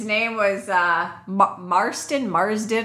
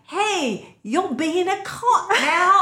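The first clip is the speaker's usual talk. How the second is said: bad British accent; 8 semitones higher, much slower than usual. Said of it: "I'm terrible with the English accent".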